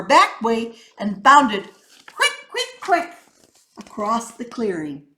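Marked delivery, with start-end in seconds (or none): 2.1-3.1 much higher, quick bouncy squeak; quick squeaky hops. A narrator's reading. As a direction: bounce each word up high like a fleeing rabbit. Rabbit bounding away.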